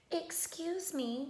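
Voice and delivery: put-on old woman, bright and gentle; brightly. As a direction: pipe up kindly. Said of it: "she said brightly".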